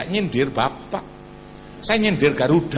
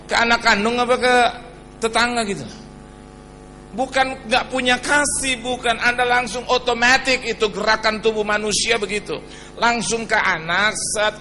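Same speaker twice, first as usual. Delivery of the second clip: louder preaching to the congregation; forceful preaching; 6 semitones higher, 5 dB louder than usual. Acted. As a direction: push it out over the crowd. (khotbah lantang kepada jemaat)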